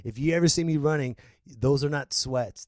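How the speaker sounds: ordinary delivery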